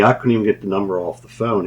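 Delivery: usual speaking voice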